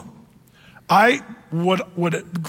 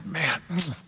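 mock grave murmur; 4 semitones lower, 10 dB quieter than usual. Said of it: "Tad's looking at the x-ray".

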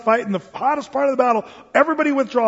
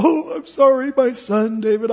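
mock wailing remorse; hammed-up weeping penitent; showy tearful apology; 4 semitones higher, 3 dB louder than usual. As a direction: blubber an apology, badly faked. Replays Saul's showy weeping apology.

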